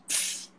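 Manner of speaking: soft hissed tss like a hi-hat; vocal hi-hat. Shown as a demonstration. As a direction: breathe out a cymbal tss. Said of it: "Hi-hat".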